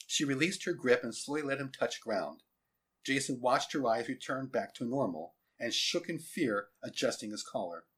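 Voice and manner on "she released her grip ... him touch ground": ordinary delivery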